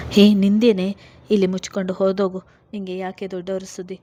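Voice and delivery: loud, fast angry bark, fading at the end; father snaps at his daughter to get lost. A narrator's reading. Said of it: "ಕೋಪದಲ್ಲಿ ನುಡಿದ ಅಪ್ಪ".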